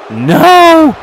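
sudden high yell of disbelief; shocked shout. Real, not acted. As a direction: scream it as the count stops. Near fall kicked out at two.

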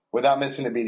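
normal talking voice

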